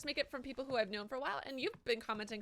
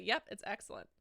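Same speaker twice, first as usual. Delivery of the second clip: normal talking voice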